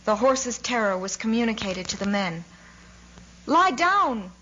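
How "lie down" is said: high, loud shouted command; alarmed officer's yell; urgent shout. A narrator's reading. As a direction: yell it to make everyone drop. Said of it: "shouted the adjutant".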